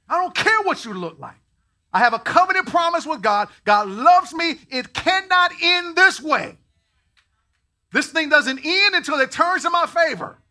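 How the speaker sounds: loud, high declaration; full-force preaching. Acted. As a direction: declare it with total faith.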